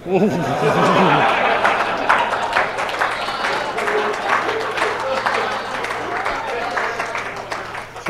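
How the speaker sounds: high wheezing laugh at the punchline; long laugh. Real, not acted. Author cracking up after his book-collecting story, wheezing laugh. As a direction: laugh hard at your own joke.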